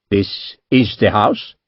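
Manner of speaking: lightly voiced wary Indian merchant, slow; guarded merchant. A narrator's reading. Merchant cautiously answering the naval captain, slow and wary. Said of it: "he said in English".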